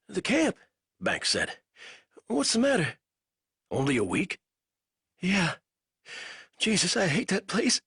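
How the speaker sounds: a weak captive fumbling for words; lightly voiced delirious prisoner; feverish prisoner. A narrator's reading. Banks voice.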